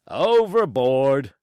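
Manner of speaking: loud, slow captain's pronouncement. Acted. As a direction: declare it, stretched and loud. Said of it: "said the captain".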